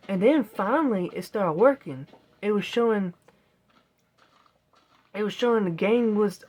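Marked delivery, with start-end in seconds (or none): none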